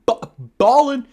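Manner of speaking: suddenly bursts out loud and high; excited shout. Real, not acted. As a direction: yell the word out in a burst of excitement.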